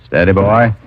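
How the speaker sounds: steady a skittish horse; soothing a horse. Acted. Calming horse during probe.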